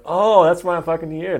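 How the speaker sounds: loud, high retold eureka moment; excited realisation. Man relives the switch when it made sense, excited revelation. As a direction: let the revelation burst out. Acts out his sudden realisation.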